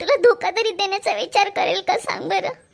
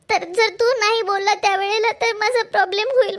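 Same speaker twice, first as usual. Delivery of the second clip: ordinary delivery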